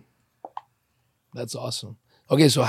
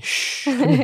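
high, hushed coaxing pet voice; coaxing the dog; 10 semitones higher than usual. Real, not acted. Hushing the dog Cannoli so it comes, coaxing. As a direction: shush and coax a pet to come over.

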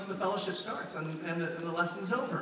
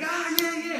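pumped-up cheer, mocked; fired-up guy; 8 semitones higher than usual. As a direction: imitate an overeager enthusiast. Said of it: "he was like those fired up guys".

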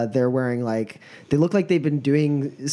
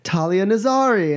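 flustered officer; 7 semitones higher than usual. Acted. Dorn rushes in to calm.

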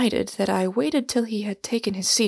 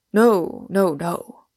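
first "No" loud, then dropping; emphatic refusal, "No" hit hard at the start; 4 dB louder, much slower than usual. A narrator's reading. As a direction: deny it firmly, punching the first "No". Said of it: "he cried emphatically".

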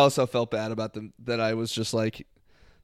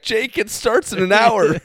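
exasperated; 9 semitones higher, 6 dB louder, slower than usual. Retold or imitated. Girlfriend's exasperated text, read out.

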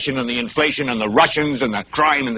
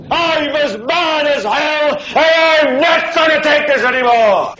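very slow, much higher furious shout; full shout; 9 semitones higher, slower than usual. Acted. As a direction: bellow it out of the open window. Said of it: "stick your head out and yell".

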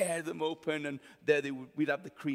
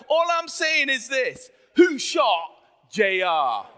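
loud, high declaration with weighty pauses; booming mock-dramatic announcement; dramatic big reveal; 8 semitones higher, 10 dB louder, slower than usual. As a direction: belt it out slowly like a cliffhanger question.

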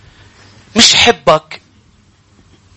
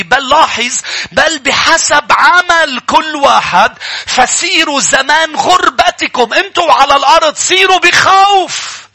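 higher, louder, building to a shout on the last line; fiery preaching; 10 semitones higher, 4 dB louder than usual. Acted. Preacher drives the verse home to his church, ending in a shout. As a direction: preach the verse with rising fire, slowing on the quoted words.

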